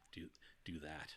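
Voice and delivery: hushed whisper, no voice. Real, not acted. Whispering to co-hosts about the crutches scene. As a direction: drop to a breathy aside. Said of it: "whispered aside".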